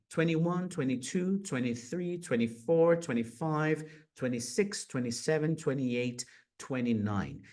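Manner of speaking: each TWENTY said as "twenny"; American-style TWENTY, no middle T; American twenty numbers. Shown as a demonstration. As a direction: count briskly, dropping the T in TWENTY. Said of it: "sumindo com esse T".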